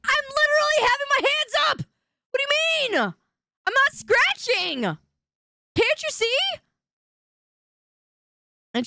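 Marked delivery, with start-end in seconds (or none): 0.1-6.3 high, strained insistence; indignant high protest. Real, not acted. Streamer protests to a chatter accusing him of scratching. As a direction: shriek your innocence.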